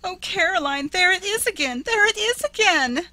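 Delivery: very high, loud wail; wild wail. A narrator's reading. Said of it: "burst out in a wild wail".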